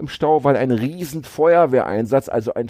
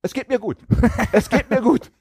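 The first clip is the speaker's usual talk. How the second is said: chirpy; 9 semitones higher than usual. Acted. Cheerful answer after prompting.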